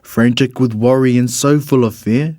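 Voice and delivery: ordinary delivery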